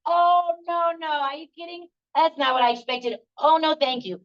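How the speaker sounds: high-pitched mock disbelief; dismayed refusal. Someone turns down the life Hashem hands them, mock dismay. Acted refusal of life's surprise.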